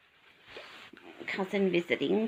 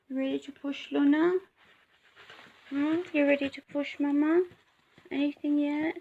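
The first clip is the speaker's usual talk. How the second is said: pet talk; 5 semitones higher, 5 dB louder than usual. Real, not acted. Coaxing dog in labour.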